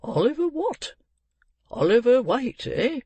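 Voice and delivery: high, rising questions; puzzled old gentleman. Acted. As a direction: query the name, voice leaping up.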